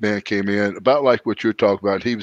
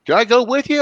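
pitched-up mimic of the older friend calling out; Seth's voice; 13 semitones higher than usual. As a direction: put his eager friendly voice on, pitched up high. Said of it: "He goes".

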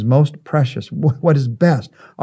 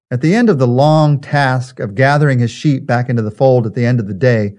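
usual speaking voice

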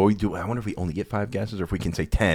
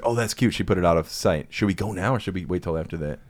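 usual speaking voice

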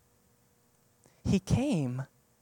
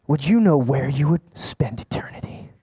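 mock whisper; 4 semitones lower, 4 dB louder than usual. Mocking a fear-based altar call.

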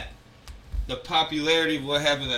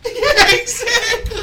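laughing; 10 dB louder, slower than usual. Real, not acted. Laughing hard at the French waiter story.

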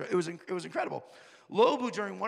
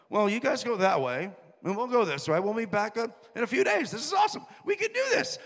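louder, climbing ever higher with naive excitement; eager disciples; 4 semitones higher, 6 dB louder than usual. Retold or imitated. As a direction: hype the disciples up, building to a squeal.

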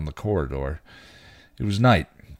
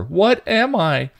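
slow, loud, very high cry; desperate demand; 13 semitones higher, 7 dB louder, slower than usual. A narrator's reading. Dawn shrugs off Buffy, desperately demanding. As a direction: drag it out, demanding to know.

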